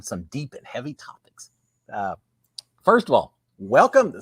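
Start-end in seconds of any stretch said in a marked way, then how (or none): 0.0-1.5 whisper. Real, not acted. Teasing heavy topics ahead.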